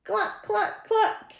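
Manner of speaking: very high, short hen clucks; clucking hen. Acted. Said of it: "and the hen said".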